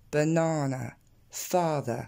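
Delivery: BANANA and FATHER both ending in neutral "uh"; matching weak endings. Shown as a demonstration. Teacher comparing the ER to banana, English pronunciation. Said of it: "je le prononce comme un, comme dans la banane".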